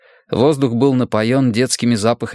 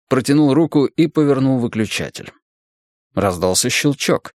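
ordinary delivery, nothing marked

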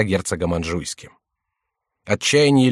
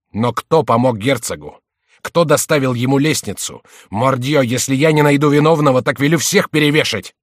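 furious threat; 4 semitones higher, 6 dB louder than usual. A narrator's reading. King rages threatening hanging.

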